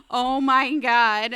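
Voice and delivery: loud, drawn-out dismay; retold alarm. Patient overhearing a miscount of cottons, alarmed. Hears sponge count come out wrong.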